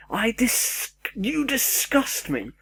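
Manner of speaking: high, slow, exaggerated "you disgust me" sneer; mock disgusted commenter. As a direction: ham up the offended commenter, drawn out high.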